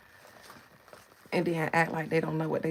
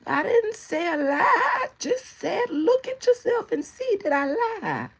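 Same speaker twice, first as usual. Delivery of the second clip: put-on much higher, louder innocent old-lady squawk; shady old lady; 6 dB louder than usual. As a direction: play dumb, loud and shrill-pitched. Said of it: "they always act like they ain't said nothing shady".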